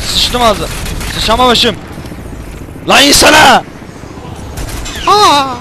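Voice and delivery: loud high frustrated screaming; loud furious cries of frustration; raging yell. Real, not acted. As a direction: lose it at the game. Raging at the game.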